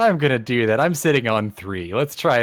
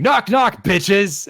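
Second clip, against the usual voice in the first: loud, high gleeful attack yell; screamed battle cry; 9 semitones higher, 7 dB louder, much slower than usual. Acted. Player's character teleporting in to swing at a guy, screaming. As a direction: scream it as you leap into the fight. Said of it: "while screaming".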